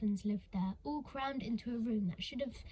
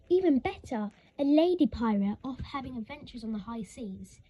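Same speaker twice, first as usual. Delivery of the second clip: excited; 10 dB louder than usual. Acted. Elsie excited about pirate ma.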